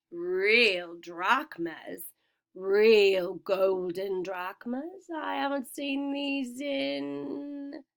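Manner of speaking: very slow, savoring greed; greedy. A narrator's reading. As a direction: linger over each word with greed. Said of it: "hovered greedily".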